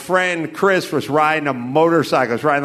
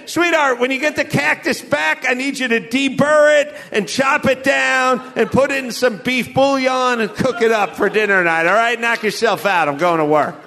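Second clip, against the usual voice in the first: mock wife's orders; 6 semitones higher than usual. Quoting wife's instructions on cactus.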